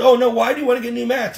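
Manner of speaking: quick, high-pitched objection; put-on fretful voice; mock dismayed patient. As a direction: imitate her protesting in dismay. Mimics Donna objecting to new mats.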